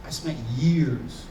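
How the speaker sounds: ordinary talk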